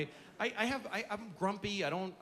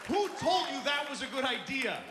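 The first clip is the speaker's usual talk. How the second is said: loud, high exasperated shout; yelling at parents; 9 semitones higher, 4 dB louder than usual. Acted. At TV-buying parents, a loud, high exasperated yell. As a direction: scream it at idiot parents.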